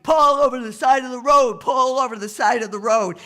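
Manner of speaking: loud, high shouted police order; blaring loudspeaker bark. Acted. Police order the truck to pull over, barked loud. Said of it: "police's loudspeaker is blaring and barking".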